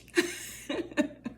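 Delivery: high, airless laugh at herself; sheepish giggle; embarrassed laugh. Real, not acted. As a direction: laugh off the mix-up. Laughs at mixing up church names.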